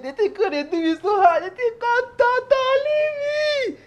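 high wailing mock sob; mock crying. Man watching a woman dance, mock crying. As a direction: whimper like a man undone by desire. Overcome by her dancing.

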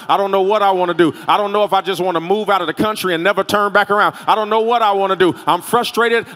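overwhelmed, building to a loud, unbroken rant; frustrated rant. Acted. Preacher voices an overwhelmed believer at breaking point, frustrated rant.

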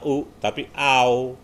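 "au" slow, gliding from a to u; diphthong au. Shown as a demonstration. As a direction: glide "au" slowly. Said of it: "A sama U bukan A".

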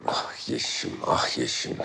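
low, unvoiced murmur to oneself; hushed, low lament; rueful sigh. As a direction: sigh the name out under your breath. Sighing over Yeşim alone.

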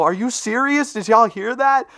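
can't believe his voice cracked again; incredulous. Real, not acted. Gamer asks viewers if they heard his voice crack, disbelieving.

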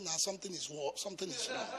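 mocking onlookers. Onlookers judging the poor preacher, soft mocking murmur. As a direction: mutter it like a doubting neighbour. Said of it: "They say".

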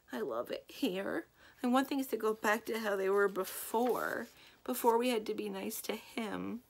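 a hint of a teary girl; lightly voiced tearful girl; sad Hyacinth.

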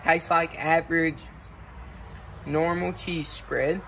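chewing between words; talking while chewing. Real, not acted. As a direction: mouth partly full. Seen 0: Bites food at -12; lips stay pressed and working while speaking.

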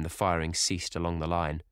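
plain reading voice

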